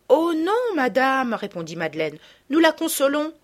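put-on a young girl hurrying to reply; eager girl. Madeleine answers the lady quickly, high and eager.

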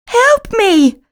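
high, loud stuck cat calling for help; cry for help. A narrator's reading. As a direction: plead from inside the vase. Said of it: "cries Blackie".

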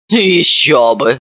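low, slow scornful scoff; contemptuous snort. A narrator's reading. Mother scoffing contemptuously at Serafima. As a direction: scoff at her. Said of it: "презрительно хмыкнула".

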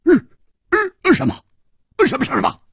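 high, shocked, tripping over words; 震惊结巴; stunned stammer. A narrator's reading. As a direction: stutter in disbelief. Hu stunned by news.

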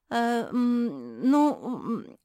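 high, loud, wavering hemming and hawing; stammering mumble. A narrator's reading. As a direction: stall with drawn-out ums. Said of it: "забормотала Мафи".

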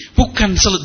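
normal talking voice

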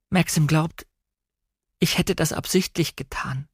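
tearful, breaking confession. A narrator's reading. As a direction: let the voice thin out as tears threaten. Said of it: "meine Stimme brach".

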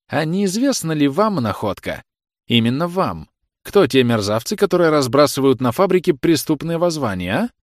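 high, loud, needling questioning; sneering interrogating officer. A narrator's reading. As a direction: press the suspect as a hostile officer.

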